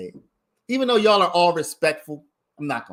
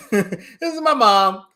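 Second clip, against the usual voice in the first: laughing; 6 semitones higher, 5 dB louder, slower than usual. Real, not acted. Repeats it laughing.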